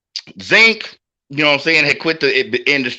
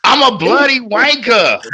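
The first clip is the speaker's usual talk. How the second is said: mock British accent; 9 semitones higher, 4 dB louder than usual. Shown as a demonstration. Echoes the British insult.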